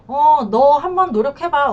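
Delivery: acted challenge. Wronged wife testing her husband's effort, acted challenge.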